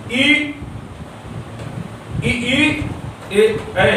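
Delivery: slow isolated vowels ई, ई, ए, ऐ, each held apart; vowel demo. Shown as a demonstration. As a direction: sound out ई ए ऐ one by one for the class. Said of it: "ये जीभ के अगले भाग से".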